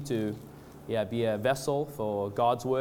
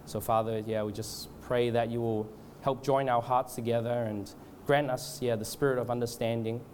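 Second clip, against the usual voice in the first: subdued, slightly lowered prayer voice; hushed-down, even, halting extempore prayer; leading prayer; 3 semitones lower, 3 dB quieter than usual. Real, not acted. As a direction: pray aloud reverently, feeling for the words. Leads the congregation in prayer.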